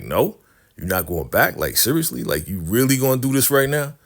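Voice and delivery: can't believe he's refusing; incredulous. Acted. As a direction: build in pitch as you question him in disbelief. Captors react to Peter refusing.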